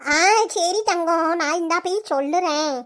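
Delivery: put-on old grandmother. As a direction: drag each word like a confused grandma. Grandmother agreeing.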